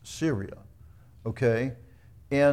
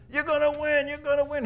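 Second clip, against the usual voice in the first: much higher, sing-song mock cheer; sarcastic mock prophecy; 13 semitones higher, 3 dB louder, faster than usual. Micaiah mocking King Ahab by echoing his flatterers, sarcastic high sing-song. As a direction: parrot the flatterers with a sneer.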